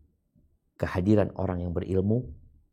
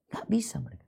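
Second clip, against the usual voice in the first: whisper; 12 semitones higher, 5 dB quieter than usual. Acted. Preacher's aside after verse.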